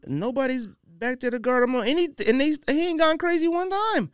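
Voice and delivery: ordinary talk, nothing marked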